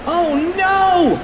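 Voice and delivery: high-pitched comic cry of alarm; squealed shout of dismay; panicked shout. Acted. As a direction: shout it way up high, like a cartoon kid in trouble. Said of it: "shouted Cheeseball".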